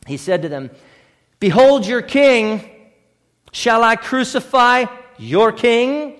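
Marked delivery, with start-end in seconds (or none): 1.4-6.1 slow, loud, scornful taunt as Pilate; sarcastic mockery. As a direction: sneer it out slowly and loudly.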